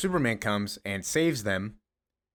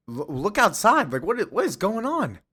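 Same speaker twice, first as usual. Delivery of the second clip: panicked Jimmy; 10 semitones higher than usual. Retold or imitated.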